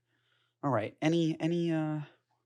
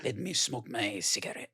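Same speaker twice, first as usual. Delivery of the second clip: put-on French accent; 4 semitones lower, 8 dB quieter than usual. Mock Frenchman with cigarette.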